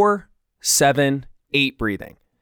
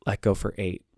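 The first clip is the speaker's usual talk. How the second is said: calm, soft breathing cues; 3 semitones lower, 8 dB quieter than usual. Acted. Leads a breathing exercise.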